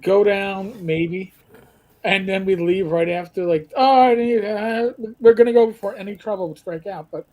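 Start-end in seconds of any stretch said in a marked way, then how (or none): none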